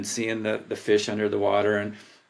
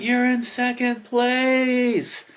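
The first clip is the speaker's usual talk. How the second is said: mock yell; 12 semitones higher, much slower than usual. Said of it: "somebody yelled".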